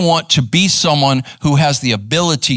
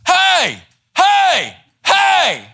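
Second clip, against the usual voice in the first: rude shouted hey; much slower than usual. Retold or imitated. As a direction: bark it out like an angry stranger. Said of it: "just rude, man".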